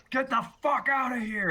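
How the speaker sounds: loud, high-pitched shout from offstage. Acted. Game master acts shouting from the kitchen, shouting. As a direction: yell it as if throwing someone out. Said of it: "you hear some shouting".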